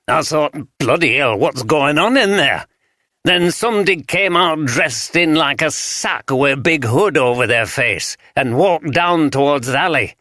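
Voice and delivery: a light broad Yorkshire voice for a wary rough sleeper; broad Yorkshire accent. Acted. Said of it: "spoke in the broad Yorkshire accent".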